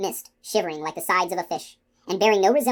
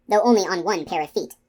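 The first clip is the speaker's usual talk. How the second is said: ordinary delivery, nothing marked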